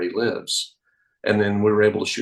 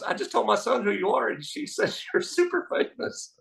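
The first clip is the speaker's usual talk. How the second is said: very high, softer mock excitement; imitated excited mother; 13 semitones higher, 4 dB quieter than usual. Magician mimicking a mom reporting her son's verdict, excited. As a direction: squeal it lightly, breaking into a laugh.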